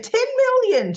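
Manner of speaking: sudden high, loud bid; bold outbid. Retold or imitated. Holly jumping up to outbid at auction, high and loud. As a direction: fling the number out high and proud.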